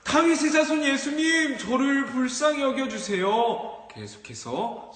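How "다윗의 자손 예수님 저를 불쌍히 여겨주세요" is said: high, loud desperate call; louder crying out. Acted. Blind beggar shouting louder over the scolding crowd, desperate cry. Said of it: "더 큰 소리로 외쳤어요".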